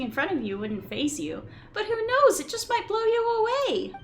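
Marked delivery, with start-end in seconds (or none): 1.7-3.9 squeaky companion, thrilled and alarmed; Paimon voice. Acted. Voices Paimon reading game lines.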